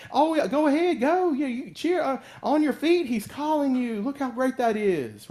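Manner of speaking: high, loud mock encouragement; cheering on. Crowd now urging Bartimaeus to his feet, high excited cheering. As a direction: gush like a fickle crowd urging him up.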